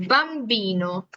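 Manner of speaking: bambino drawn out, lips pressed on the b; slow bambino. Shown as a demonstration. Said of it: "con los labios casi pegados".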